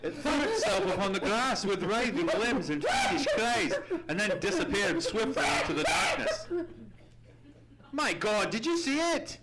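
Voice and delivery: high, loud, horrified cry; frightened shout. Acted. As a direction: yell it out in terror at the thing in the dark. Watson terrified by the hideous creature.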